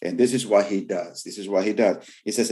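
normal talking voice

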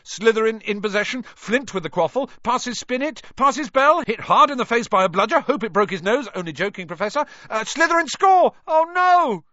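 high, loud rapid play-by-play; excited commentator. Acted. Lee commentating to the crowd, high excited play-by-play. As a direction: call the match, rising to a dismayed cry. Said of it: "Lee was still commentating".

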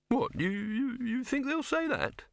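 high, hesitant, incredulous stammer; stammering disbelief. A narrator's reading. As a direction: stumble upward into a flattered question. Flattered partner stammers in disbelief.

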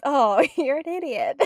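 slow, loud, laughing translation; mock insult. Shown as a demonstration. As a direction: spell out the hidden insult, laughing. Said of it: "basically means".

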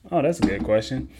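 normal talking voice

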